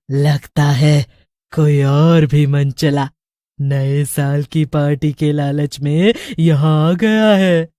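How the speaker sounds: low, slow sinister witch voice. A narrator's reading. Witch ordering her coven to seize the new fool. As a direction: gloat and give orders like a witch queen. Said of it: "चुड़ैल बोलती है".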